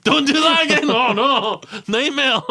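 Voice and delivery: hammed-up laughing scolding; mock boss reprimand. Host mocking the lax police chief, laughing loudly.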